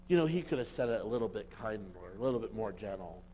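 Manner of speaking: quick, dismissive mimicry of a critic; mock critic. Preacher mimicking critics of Jesus, quick and snide. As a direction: rattle it off like a smug critic. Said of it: "He shouldn't say those kind of things".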